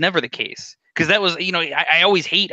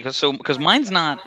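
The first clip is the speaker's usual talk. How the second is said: ordinary delivery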